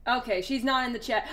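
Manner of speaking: ordinary delivery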